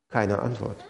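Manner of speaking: hushed. Acted. Pastor lets the answer sink in.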